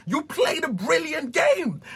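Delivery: high, bright shouted praise; hyped quote. Retold or imitated. Friends consoling Alan, a high hyped shout. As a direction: cheer it high. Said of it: "They're like".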